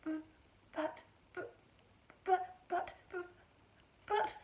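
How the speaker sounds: halting broken buts with pauses; stammering. A narrator's reading. Mother stammering at the sight of the hen. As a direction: stumble over every but in shock. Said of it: "stammered George's mother".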